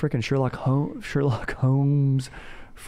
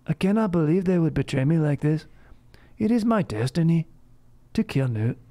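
hammed-up period-drama hero, aggrieved and sniffy; mock Uhtred; 7 semitones higher than usual. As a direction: talk as if everyone just farted around you. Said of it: "Uhtred just the whole time just talking like".

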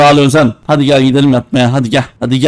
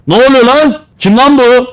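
alarmed; 8 semitones higher than usual. Real, not acted. Scared by ghost.

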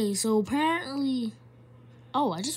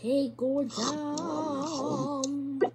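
very drawn out; 5 semitones higher, much slower than usual. Pretend. Handing food to game character.

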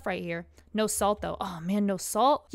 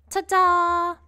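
cheerful ta-da; 9 semitones higher, 9 dB louder than usual. Acted. Presenting tomatoes to brother.

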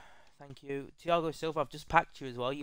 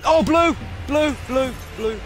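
much higher, much louder yelling; excited shout; 12 semitones higher, 8 dB louder than usual. Real, not acted. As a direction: scream it out in excitement. Sees a blue rare card.

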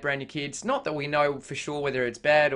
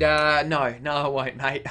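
ordinary talk, nothing marked

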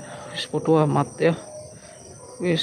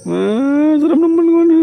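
spooked; 10 semitones higher, 4 dB louder, slower than usual. Real, not acted. Scared reaction to eerie scene.

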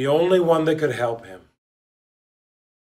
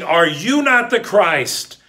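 ordinary talk, nothing marked

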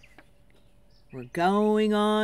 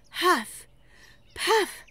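mock panting; 7 semitones higher, 3 dB louder than usual. Miming climbing effort.

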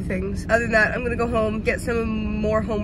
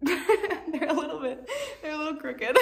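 higher, laughing through the words; 7 semitones higher than usual. Real, not acted. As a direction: giggle while showing the glasses. Laughing at crooked glasses.

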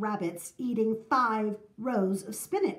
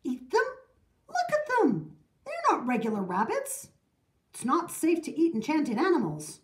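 high-pitched indignant outcry; mild shout; 6 semitones higher than usual. A narrator's reading. Baker's wife objecting to eating rabbits, high mild shout. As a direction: protest in disbelief, pitch leaping up. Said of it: "The baker's wife shouted".

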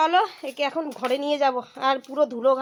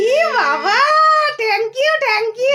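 high, loud squeal of thanks; loud, high-pitched happy exclamation; delighted thanks; 12 semitones higher, 10 dB louder, much slower than usual. Real, not acted. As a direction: gush with surprised delight. Thrilled reply to Valentine's wish.

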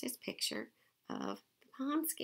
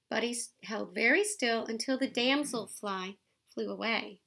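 lively picture-book read-aloud; reading to children; 8 dB louder, slower than usual. A narrator's reading. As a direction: read it out to the kids, bright and clear.

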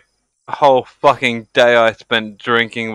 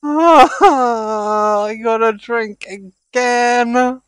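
laughing; 11 semitones higher, much slower than usual. Real, not acted. Laughing at losing a bet.

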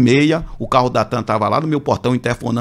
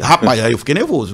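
ordinary delivery

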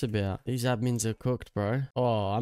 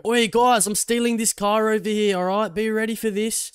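higher, louder hammed-up call-out; mock car thief announcing; 8 semitones higher, 5 dB louder than usual. Gamer mocking how a thief would announce stealing a car, loud hammed-up call. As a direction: ham up a thief shouting his plan. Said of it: "you're not gonna go".

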